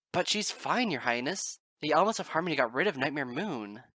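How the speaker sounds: high, loud worried insistence; protest, desperate to reassure; anxious protest. A narrator's reading.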